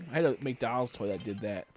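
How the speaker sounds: ordinary delivery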